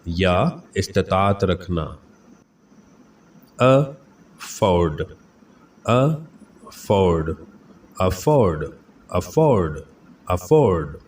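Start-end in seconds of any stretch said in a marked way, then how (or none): 3.6-7.3 advertising stretched out very slowly; teacher slowly modelling the word for students; slow syllable by syllable. Shown as a demonstration. Slow, careful pronunciation drill. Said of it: "pronunciation drill".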